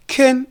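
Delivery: "can" for can't, no T; dropped final T. Shown as a demonstration. Teacher showing how we say it, T dropped from "can". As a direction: stop "can" on the N. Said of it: "The T is dropped".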